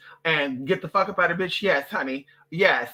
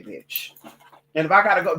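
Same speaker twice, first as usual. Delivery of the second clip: ordinary talk, nothing marked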